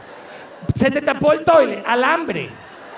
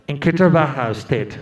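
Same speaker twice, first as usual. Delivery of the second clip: low, deliberate officer voice; a gringo official asking flatly; American officer; 9 semitones lower than usual. Retold or imitated. Visa officer asks the applicant, low and deliberate. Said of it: "el hombre le pregunta".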